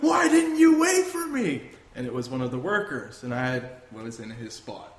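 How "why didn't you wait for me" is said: high, loud indignant shout, imitating a caller; angry caller. Worker on the phone to the truck, imitated indignant shout. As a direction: yell it in protest, voice pitched way up. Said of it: "and the guy's going".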